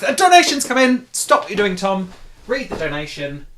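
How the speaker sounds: loud, high excited call-out; excited shout. Real, not acted. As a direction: announce it like breaking news. Donation alert interrupts talk.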